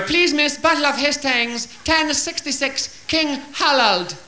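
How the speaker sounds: high, slow careful schoolgirl answer; polite schoolgirl. Acted. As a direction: recite it primly for teacher. Japanese girl answers teacher.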